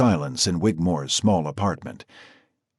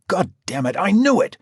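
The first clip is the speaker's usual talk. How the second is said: exasperated; 10 semitones higher than usual. A narrator's reading. Louis exasperated outburst.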